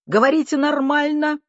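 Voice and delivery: very high, loud yell; shouting. A narrator's reading. Deaf aunt from kitchen to both men, shouting. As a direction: yell it at full pitch. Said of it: "крикнула из кухни".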